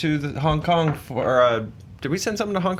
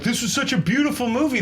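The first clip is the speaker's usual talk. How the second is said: higher, gushing fan quote; mock fan voice; 8 semitones higher than usual. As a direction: lightly imitate a gushing viewer. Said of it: "people keep saying".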